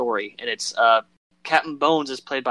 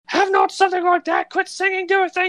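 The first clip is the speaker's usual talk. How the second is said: falsetto landlady shout, much higher; scolding landlady; 5 dB louder than usual. Retold or imitated. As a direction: nag them from upstairs. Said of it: "and then she's like".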